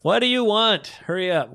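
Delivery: host teasing the listener's letter, mock impatient; impatient mock demand. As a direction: hurry them along in jest.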